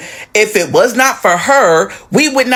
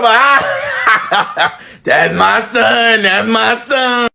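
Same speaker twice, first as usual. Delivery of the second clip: slow, laughing proud mom; laughing mother; much slower than usual. Retold or imitated. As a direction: laugh through it slowly. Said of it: "She's like".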